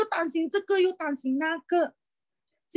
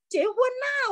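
sudden high-pitched cheer to go ahead; excited urging; 11 semitones higher, slower than usual. Retold or imitated. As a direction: brush off the worry, push him to marry. Tells brother to marry. (興奮地催促哥哥：「結婚啦！」)

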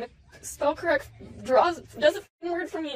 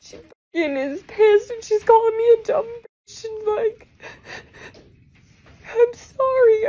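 tearful and upset; crying; 7 semitones higher than usual. Real, not acted. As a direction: voice breaking in tears. Tearful artist recounting abuse.

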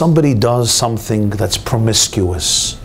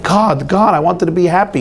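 pleading to God; 7 semitones higher than usual. Acted. Terrified husband pleading to God.

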